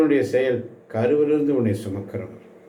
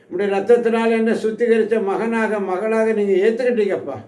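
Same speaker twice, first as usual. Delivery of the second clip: fervent prayer; 5 semitones higher than usual. Acted. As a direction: pour out a tearful plea to Father, rising. Emotional prayer to the Father. (உணர்ச்சிப் பெருக்குடன் உயர்ந்து செல்லும் ஜெபம்)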